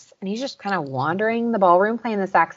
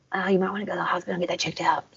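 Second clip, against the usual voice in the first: whisper; 6 dB quieter, much faster than usual. Retold or imitated. Zoot's deadpan aside, quoted.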